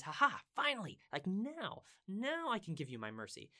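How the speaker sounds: high, loud, triumphant outburst easing slightly; acted delighted "finally"; jubilant relief. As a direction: exclaim it as if the long wait is over. Acting God's delight at repentance.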